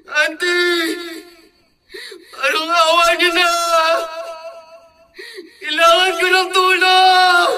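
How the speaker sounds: sobbing, shouted pleas for help; crying for help. Acted. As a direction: cry out for your aunt to save you. (umiiyak na humihingi ng tulong)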